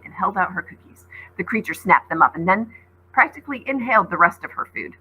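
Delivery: ordinary delivery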